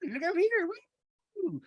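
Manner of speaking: very high, squealed mock calls; imitated distracting calls. Imitating Meredith distracting from the fight, high squeal. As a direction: squeal it to pull every eye over. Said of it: "Just trying to distract".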